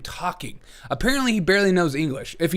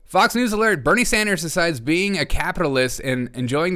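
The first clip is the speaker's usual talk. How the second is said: mock news anchor; 3 semitones higher than usual. Streamer mocking a Fox News alert, punchy. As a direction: announce it like breaking news.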